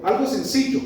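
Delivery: usual speaking voice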